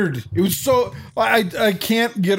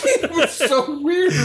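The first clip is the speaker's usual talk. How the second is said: laughing; 10 semitones higher than usual. Real, not acted. Laughing about the weird scene.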